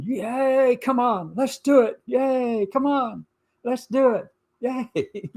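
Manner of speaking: high-pitched peppy cheer voice; mock cheerleader. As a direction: squeal it like a hammy cheerleader. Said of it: "thinks of himself as the cheerleader".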